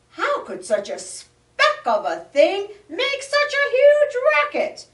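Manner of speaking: high, loud disbelief; amazed. Acted. Bear amazed the tiny frog made the racket. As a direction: marvel at how small it is. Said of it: "asked the bear".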